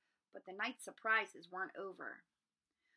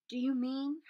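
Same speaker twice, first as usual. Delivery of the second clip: croaking; 5 semitones higher, 7 dB louder than usual. Acted. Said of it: "Harry croaked".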